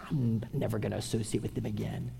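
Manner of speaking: hushed, voiceless whisper; whispered quote. Pretend. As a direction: breathe the words like a sulky aside. Quoting a sulking listener.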